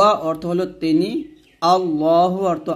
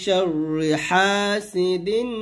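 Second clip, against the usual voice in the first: slow recitation; much slower than usual. Shown as a demonstration. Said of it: "আবার".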